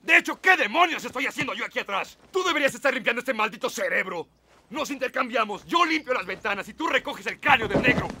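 high, loud angry rant. Acted. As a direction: argue hotly, pitch up. Heated quarrel in the car.

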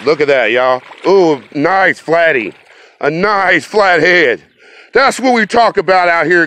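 can't contain the joy of a personal best; thrilled. Real, not acted. Angler showing viewers his record catfish, thrilled and high-pitched.

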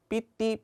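삐띠 said high as a mistaken reading; wrong pronunciation. Shown as a demonstration. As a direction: mispronounce PT as 삐띠. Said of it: "삐띠라고 하시면 사람들은 못 알아듣겠죠". (틀린 발음 삐띠)

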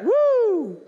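loud, high preacher's "woo!"; high whoop. Real, not acted. Preacher punctuating a scripture verse, high whoop. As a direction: let out a soaring whoop.

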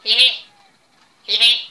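laugh. Real, not acted. Giggling at the game.

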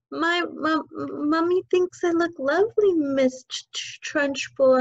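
put-on high, stammering, scared child; little girl stammering at the headmistress; frightened stutter. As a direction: stutter it out in terror. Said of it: "Amanda stuttered".

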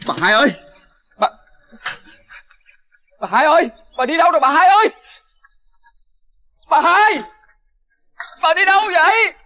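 to the empty house, calling out frantically for Bà Hai. Acted. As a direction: shout out for a missing neighbour. Searching, calling for missing woman.

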